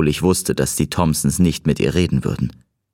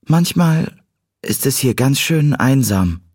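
put-on higher girl's voice, drawn out; Cat, lonely confession; 8 semitones higher, slower than usual. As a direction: slowly admit loneliness in a raised voice. Cat admits she is lonely. (hohe, langsame Mädchenstimme)

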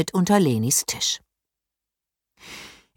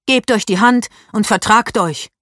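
louder, firm order; stern command; 5 semitones higher, 6 dB louder than usual. A narrator's reading. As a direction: order them to make up. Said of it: "befahl Leni streng".

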